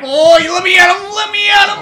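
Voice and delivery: high shout, held back from a fight; hammed-up fighting shout. [name] straining to fight, a high mock shout. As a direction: yell it straining against a grip.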